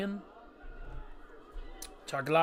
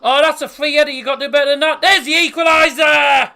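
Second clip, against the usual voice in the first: high, very loud football commentary yell; excited goal shout; 13 semitones higher, 15 dB louder than usual. Real, not acted. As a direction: build to a full-voiced equaliser roar. Live goal call on watchalong stream.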